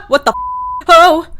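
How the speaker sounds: loud, high, half-crying laughter; frightened laugh. Real, not acted. Player after a jump scare, frightened laughing. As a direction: burst into panicky laughing yelps after a shock.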